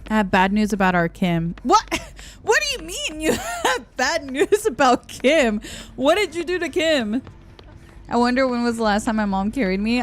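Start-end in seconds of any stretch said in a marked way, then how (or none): 1.6-7.2 high, laughing disbelief; what did you do, laughing; high amused shock; incredulous. Real, not acted. Shocked at bad news.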